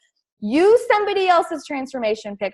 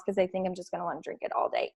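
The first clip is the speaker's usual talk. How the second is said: whispered aside; 6 semitones lower, 12 dB quieter, much faster than usual. Real, not acted. Trailing aside about the drink.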